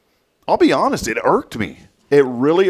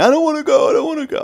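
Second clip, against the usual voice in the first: high-pitched mock child's wail; screaming child; 4 dB louder, faster than usual. Dad retells his daughter balking in line, mock child scream. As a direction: whine it like a panicking little girl. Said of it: "Braylon starts screaming".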